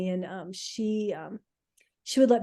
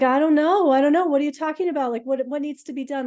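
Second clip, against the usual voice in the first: panicked confusion; 7 semitones higher, 7 dB louder than usual. Retold or imitated. Retelling her panicked reply to friend.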